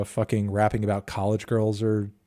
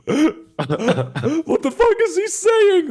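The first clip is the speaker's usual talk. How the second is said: mock baffled chat; 3 dB louder, much slower than usual. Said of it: "everybody in chat was like".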